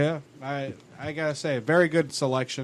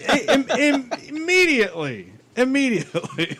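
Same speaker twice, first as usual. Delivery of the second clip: laughing; 8 semitones higher than usual. Real, not acted. Laughing while telling story.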